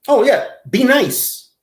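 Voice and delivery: high, loud mocking be nice; sarcastic advice. Mocking G-Man's request for advice, a sarcastic high be nice. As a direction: toss it off with a smirk. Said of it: "advice to G-Man".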